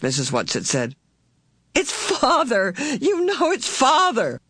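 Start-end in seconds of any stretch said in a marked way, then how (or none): 1.7-4.4 high, exasperated cry; angry impatience. A narrator's reading. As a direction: insist in a slow, high, impatient voice. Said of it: "Meg's angry impatience grew".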